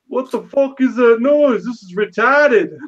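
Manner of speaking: slow, loose attempt at a griping Boston roommate; attempted Boston accent. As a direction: put on a rough Boston accent, stretched out. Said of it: "I can't even do an accent".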